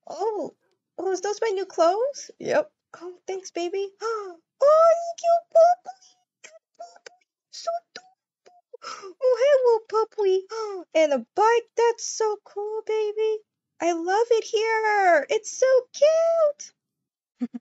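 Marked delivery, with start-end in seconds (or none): 4.0-8.1 excited squeal. Pretend. Girlfriend thanking boyfriend for the puppy, high cooing squeal. 9.2-17.5 higher, slow and gushing; delighted. Pretend. Girlfriend delighting in puppy and bike, slow gushing.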